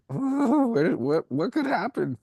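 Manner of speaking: high, startled mock panic. Telling his podcast host about his old fear, high mock panic. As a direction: squeak it out like a nervous guy.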